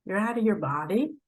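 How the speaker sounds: ordinary talk, nothing marked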